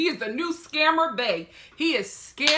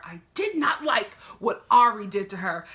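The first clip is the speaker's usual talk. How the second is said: ordinary delivery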